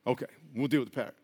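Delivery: calm the customer down in a low hush; a low, soft, placating manager promising to deal with it; apologetic manager. Acted. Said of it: "manager reassures the lady".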